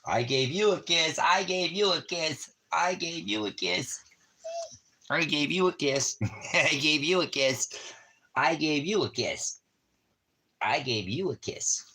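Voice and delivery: high sing-song voice for the puppy, repeated; doggy baby talk. Acted. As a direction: tease the dog, high and playful. Repeats it to the dog.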